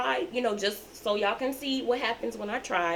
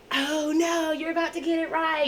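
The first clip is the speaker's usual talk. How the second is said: put-on high playful hype to a child; excited teacher; 9 semitones higher, 3 dB louder than usual. Teacher spurring a child on to learn, excited. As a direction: tease them along, high-pitched and excited. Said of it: "that gets them excited to learn".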